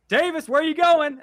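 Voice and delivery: high, loud call across the room. Retold or imitated. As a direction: shout to stop him leaving. Friend catches him sneaking away.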